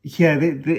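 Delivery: usual speaking voice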